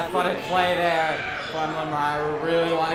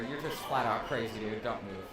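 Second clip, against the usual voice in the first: quiet; 5 semitones lower, 7 dB quieter than usual. Real, not acted. Aside during timeout.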